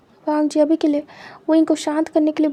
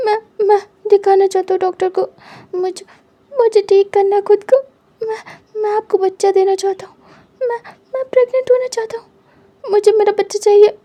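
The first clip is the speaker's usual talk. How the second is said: desperate crying; 5 semitones higher, 6 dB louder than usual. Acted. Desperate plea after losing baby.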